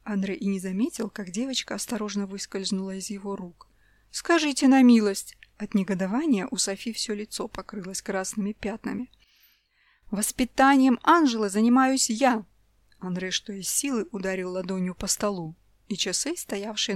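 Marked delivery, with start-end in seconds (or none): 4.1-5.4 much louder indignant cry; wife bursting with indignation; outraged. A narrator's reading. 10.1-12.4 louder, declaring it firmly; husband asserting control; angry shout. A narrator's reading.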